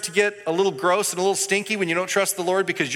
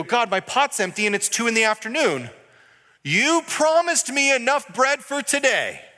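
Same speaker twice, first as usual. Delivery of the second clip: complaining to God; 6 semitones higher than usual. Retold or imitated. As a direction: plead with God, the pot is empty.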